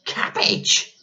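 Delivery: unvoiced, disbelieving boy; a boy aghast at the idea; disgusted boy. A narrator's reading. Boy's disgust.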